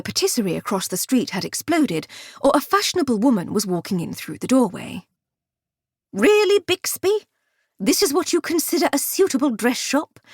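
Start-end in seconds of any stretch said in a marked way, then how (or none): 6.1-10.2 haughty society lady. A narrator's reading. Heiress scoffing at her servant, haughty and high. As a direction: sniff at the shop like a spoiled heiress. Dahlia scorns the dress shop.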